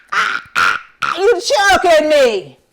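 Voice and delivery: high, loud choked cries; choking holler. Acted. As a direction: yell like a bird being strangled. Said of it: "the buzzard holler".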